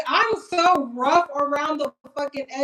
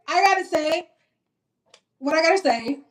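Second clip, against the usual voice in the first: usual speaking voice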